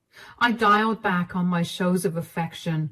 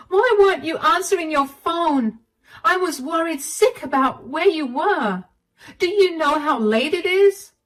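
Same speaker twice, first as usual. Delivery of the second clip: high, loud worried yell; 8 semitones higher, 3 dB louder than usual. Acted. Wife yelling at husband who came home late. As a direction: shout it at him, sick with worry. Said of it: "I ended up yelling at him".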